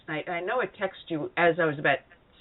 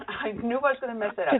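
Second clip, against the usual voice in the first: higher, rushed words after a laugh; amused, quick and higher-pitched; 6 semitones higher, faster than usual. Real, not acted. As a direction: laugh at your own slip, talking fast. Host laughs at own slip.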